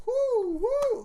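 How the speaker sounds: high owl whoo; hooting like an owl. Shown as a demonstration. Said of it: "like a normal friggin' owl".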